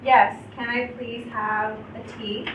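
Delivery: ordinary delivery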